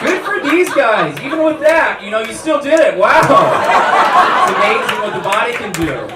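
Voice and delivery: high, loud congratulatory gushing; mock cheering. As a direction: whoop it up like impressed buddies. Acting friends cheering his fertility.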